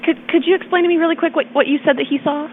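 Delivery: usual speaking voice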